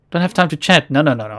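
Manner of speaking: ordinary talk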